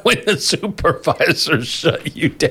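high, drawn-out words through real laughter; laughing. Real, not acted. Host relishing the supervisors' walkout to co-hosts, laughing. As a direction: stretch it out while laughing. Cracking up at the story.

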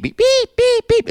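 very high squeaky beep-beep like a reversing truck; imitated truck beeping. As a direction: mimic the truck horn, high and sharp. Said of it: "driving trucks by".

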